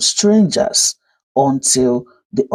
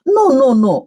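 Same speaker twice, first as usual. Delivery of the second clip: quick denial; 9 semitones higher, 4 dB louder than usual. A narrator's reading. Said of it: "Oluchi said quickly".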